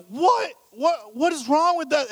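high, loud exasperated outburst; mad. Retold or imitated. Husband instantly reacting to his ruined floor, mad. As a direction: retell it as indignant disbelief, pitch shooting up. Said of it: "And I'm getting, I'm mad".

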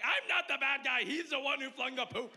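high, loud protesting self-pity through laughter; hammed-up wounded victim, shouting and laughing; mock indignant. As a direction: defend yourself like a put-upon husband, loud and laughing. Retold argument with wife.

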